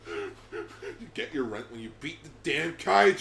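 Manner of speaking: laughing while shouting the line. Real, not acted. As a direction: repeat it through laughter. Laughing repeat.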